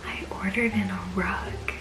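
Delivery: hushed whisper so no one hears. Real, not acted. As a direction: whisper quietly, someone is home. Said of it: "I have to whisper a little bit because Joel is home".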